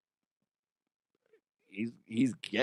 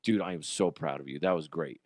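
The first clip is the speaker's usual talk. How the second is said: proud and tender; 7 semitones lower, 5 dB quieter, faster than usual. Retold or imitated. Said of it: "I'm like".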